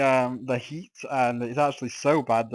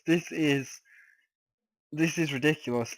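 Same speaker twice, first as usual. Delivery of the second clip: ordinary talk, nothing marked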